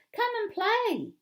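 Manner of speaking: loud, high excited invitation; eager playground yell. A narrator's reading. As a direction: call a friend over to join the game. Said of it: "he yells".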